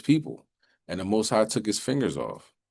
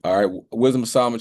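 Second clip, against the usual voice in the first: usual speaking voice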